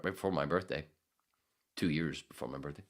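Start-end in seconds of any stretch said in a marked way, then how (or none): none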